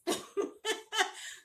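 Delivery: high-pitched burst of laughter; laughing. Real, not acted. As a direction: laugh at your own mimicry. Amused by own butterflies imitation.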